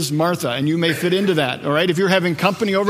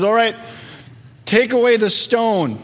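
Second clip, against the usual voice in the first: commanding Jesus; 5 semitones higher than usual. Acted.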